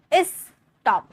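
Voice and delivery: स्टॉप split into "is" and "top"; wrongly split syllables. Shown as a demonstration. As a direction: put a clear gap in स्टॉप, "is... top". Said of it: "ऐसे थोड़ी बोल रहे हैं".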